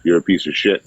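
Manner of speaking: ordinary talk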